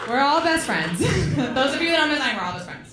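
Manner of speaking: ordinary talk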